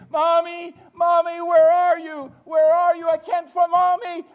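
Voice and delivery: high, very loud cries for mother; screaming lost child. Acted. Lost child calling for Mommy in a mall, screaming. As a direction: call out desperately as a lost child. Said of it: "and they're screaming".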